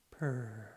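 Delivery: low, soft contented purr. Acted. Muffin the cat purring in the bear hug, soft. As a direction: purr softly like a happy cat.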